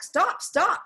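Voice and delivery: high calling yell; yelling after wagon. A narrator's reading. Jack chasing the driver's wagon, high yell. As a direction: call out to stop the wagon.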